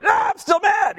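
high, strained frustrated cry; exaggerated angry whine; mock exasperated outburst. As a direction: blurt it out like you're fed up. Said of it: "I'm like".

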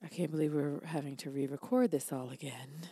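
low, soft, worn-out mimicry; very tired. Co-host retelling a re-recording, tired low voice. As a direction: imitate weary hosts, voice dropped low and quiet. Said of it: "we both sound very tired".

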